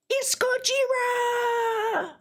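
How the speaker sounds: high, slow, stretched shout of the title; hammed-up reveal. Podcaster unveiling his third movie pick, a drawn-out high shout. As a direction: belt it out like a monster movie trailer.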